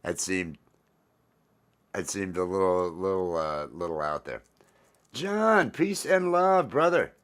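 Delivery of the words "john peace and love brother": loud, high shout-out to a friend; big high greeting, arms wide open; calling out. Real, not acted. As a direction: hail him like across a room. Shout-out to a chat viewer.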